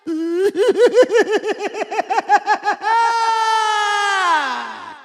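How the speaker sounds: very long, high maniacal cackle; manic cackle. Acted. Villain can't stop laughing, long manic cackle. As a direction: let a shrill cackle run on and on.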